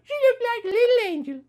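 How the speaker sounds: squealed high and quick, adoring; high, fast gushing coo. A narrator's reading. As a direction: coo it fast like over a baby. Gushing aside about flower girl.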